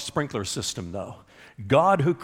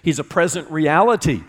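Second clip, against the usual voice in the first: high, loud, ringing preacher's cry; full-force preaching; 8 semitones higher, 6 dB louder than usual. Acted. Pastor pleads with his congregation, preaching at full force.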